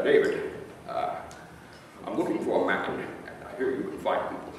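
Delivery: a light Scottish star voice; Sean Connery impression. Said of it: "And he said".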